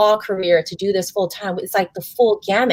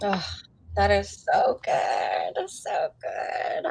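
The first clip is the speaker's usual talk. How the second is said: breathy moved reaction; 8 dB quieter, slower than usual. Real, not acted. Host moved after the guest's advice, voice catching. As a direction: voice catching, almost whispered.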